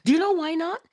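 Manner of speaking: usual speaking voice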